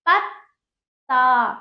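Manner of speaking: पत्ता slowly as पत्…ता, long final aa; half-letter demonstration. Shown as a demonstration. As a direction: stop on half त, then land a full ता in पत्ता. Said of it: "उससे पहले आधे ता की आ रही है".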